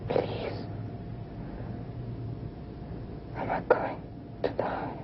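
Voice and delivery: faint whisper. Acted. Dying, fading whisper.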